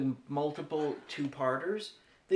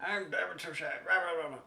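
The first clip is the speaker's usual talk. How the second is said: David Suchet; 3 dB quieter than usual. Retold or imitated. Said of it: "David Suchet being vaguely menacing".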